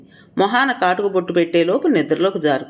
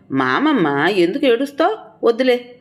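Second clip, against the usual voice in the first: higher, sing-song cooing; cooing to a child; 7 semitones higher than usual. Acted. Father hushing his crying little daughter, high cooing voice. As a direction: coax the crying baby gently up high.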